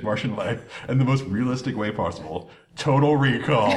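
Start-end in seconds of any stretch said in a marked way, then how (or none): 2.8-3.7 dramatic announcement. Real, not acted. Dramatic reveal, laughing.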